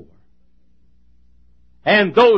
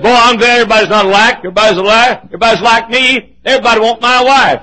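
joke voice; 5 semitones higher, 8 dB louder than usual. Retold or imitated. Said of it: "Fellow said".

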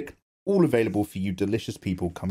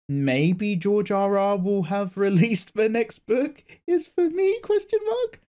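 rising mock plea; 8 semitones higher, slower than usual. Joking plea to George R R.

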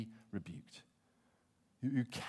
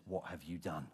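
lower, grave demand; stern rebuke; 9 semitones lower, 6 dB quieter than usual. Retold or imitated. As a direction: ask it low and heavy like a judge. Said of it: "Samuel comes along and he says".

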